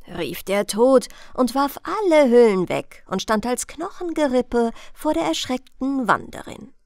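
ordinary delivery

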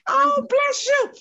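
high, loud, slow outpouring; touched and thrilled by the thanks; delighted blessing. Real, not acted. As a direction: exclaim it with soaring pitch. Moved by former student's thanks.

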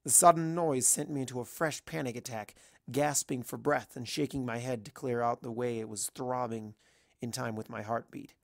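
usual speaking voice

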